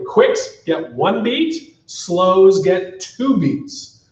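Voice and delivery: "Quicks get one beat, slows get two beats" spelled out slowly and deliberately; slow emphatic explanation. Shown as a demonstration. Teacher stressing the timing rule for students, slow and deliberate. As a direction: lay out each word slowly so it sinks in. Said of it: "I'm just gonna say this really quickly".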